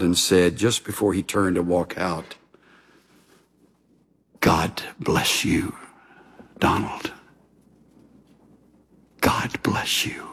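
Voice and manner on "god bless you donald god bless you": soft, slow, near-whispered farewell blessing; low, breath-soft words from an old mentor; tender blessing. Retold or imitated. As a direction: bless him gently, hushed and unhurried. Old professor's parting blessing, retold.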